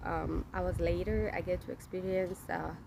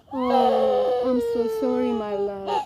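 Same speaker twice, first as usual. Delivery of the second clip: higher, louder motherly sympathy; tender consoling; 4 semitones higher, 10 dB louder, slower than usual. Real, not acted. Mother apologises to her crying child, tender consoling. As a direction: console a crying little one over the noise.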